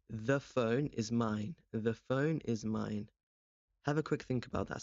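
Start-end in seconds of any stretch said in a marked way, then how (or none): 0.1-3.1 slow, deliberate, each word laid out; spelled-out classroom example; slow model sentence. Shown as a demonstration. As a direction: say it slowly for learners to repeat. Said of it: "How would I say".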